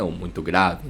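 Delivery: "grave" dito bem mais grave; desça a voz para o fundo. Shown as a demonstration. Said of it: "A frequência". (voz grave de demonstração)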